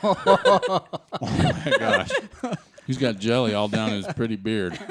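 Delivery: cracking up mid-sentence; laughing. Real, not acted. Jelly on friend's beard.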